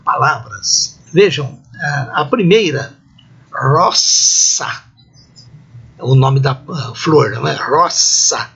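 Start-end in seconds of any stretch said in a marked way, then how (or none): 3.5-4.8 roça drawn out with a long hissed s; "roça" as Latin rosa with unvoiced s; voiceless s between vowels. Shown as a demonstration. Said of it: "sibilante surda". 7.5-8.5 roça stretched on an unvoiced s; "Roça" as Latin rosa, hissing s; voiceless s between vowels. Shown as a demonstration. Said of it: "sibilante surda".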